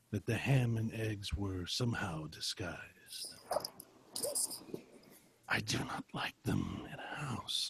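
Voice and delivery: soft eerie whisper. Acted. Narrator building dread for classmates, whispered. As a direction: hush it like a creeping dread. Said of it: "Sam felt the oddest sensation".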